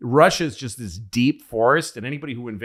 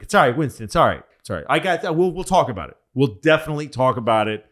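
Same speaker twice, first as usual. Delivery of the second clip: higher, insistent fobbing-off; placating brush-off; 5 semitones higher, 5 dB louder, much faster than usual. Retold or imitated. FDR fobbing off Winston about their plan, placating. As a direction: placate him to get rid of him.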